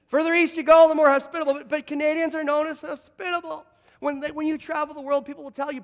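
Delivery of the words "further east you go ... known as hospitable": much louder, high-pitched repeat; rousing call for amens. Real, not acted. Preacher repeats it to get more amens, loud and rousing. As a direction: belt it out to rouse the congregation. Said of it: "thought I'd get more amens".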